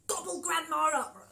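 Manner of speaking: gobbling wolf. Shown as a demonstration. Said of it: "Now you show me".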